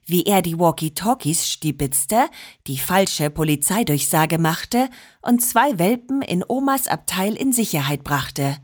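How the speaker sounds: plain reading voice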